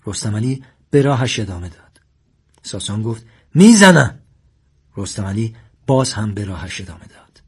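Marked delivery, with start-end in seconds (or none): 3.5-4.2 high, very loud warning; shouted threat. A narrator's reading. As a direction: yell it as an escalating threat. Armed man repeats his threat louder. (تهدید با فریاد بلند)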